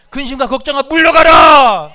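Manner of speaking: drawn-out, high, very loud rebuke; commanding shout. Real, not acted. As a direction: roar it out, stretching the last word. Preacher drives out worry. (길게 내지르는 우렁찬 명령)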